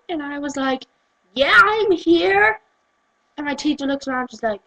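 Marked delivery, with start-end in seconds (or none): 1.3-2.6 put-on drawn-out, high American accent; loud, exaggerated American reply.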